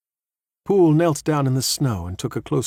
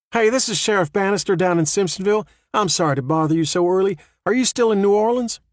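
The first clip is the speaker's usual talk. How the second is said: a hint of a higher sheriff voice; lightly voiced female sheriff; 9 semitones higher, 4 dB louder than usual. A narrator's reading.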